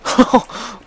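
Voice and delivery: high airy burst of laughter; delighted breathy laugh. Real, not acted. Surprise success in game.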